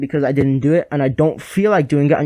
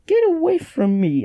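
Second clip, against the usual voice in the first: mock distressed cry; 14 semitones higher than usual. Reviewer acts out the fight with Spider-Man, high mock cry. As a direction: squeal it out in a much higher voice. Acting out an angry rejection.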